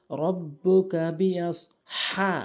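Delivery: slow রব্বুকা বিআস হা, হা strong from mid-throat; correct big ha. Shown as a demonstration. Teacher modelling the verse, throaty long হা. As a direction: draw out হা with friction from mid-throat. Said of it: "বড় হা এনে হলকের মাঝখান থেকে".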